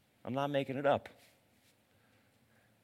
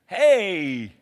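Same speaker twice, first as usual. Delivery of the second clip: much higher, loud shout of "Yo, bro"; excited buddy greeting; 8 semitones higher, 14 dB louder, much slower than usual. Acted. God hailing Abraham like a buddy, loud excited whoop. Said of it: "It's like God's going".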